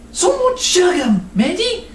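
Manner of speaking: lightly voiced little nun, high; a small girl's eager question; high child voice.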